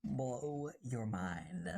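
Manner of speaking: very slow, low "Blow your mind"; drawn-out low echo for effect; mock-mystical echo. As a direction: draw out each word low and slow. Echoes own phrase for effect.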